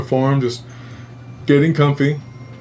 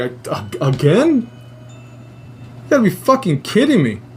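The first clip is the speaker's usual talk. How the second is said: exasperated disbelief; 4 semitones higher than usual. Retold or imitated.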